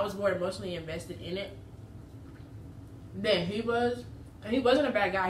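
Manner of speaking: eating from hand while speaking; talking while chewing. Real, not acted. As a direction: mouth partly full of food. Seen 2: Bites sandwich before, hand feeding mouth through 2.0s, then lowered.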